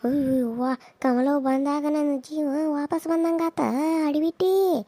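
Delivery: ordinary delivery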